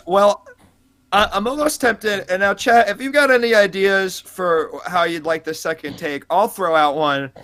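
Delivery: ordinary talk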